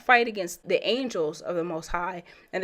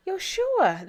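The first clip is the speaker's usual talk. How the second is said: excited reveal; 12 semitones higher than usual. Real, not acted. Guess who appears, reveal.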